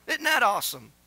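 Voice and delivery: normal talking voice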